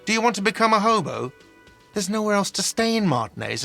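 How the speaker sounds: high, insistent badgering. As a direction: press them with the threat of homelessness. Pressing a broke guest to pay.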